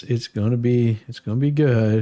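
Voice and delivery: ordinary delivery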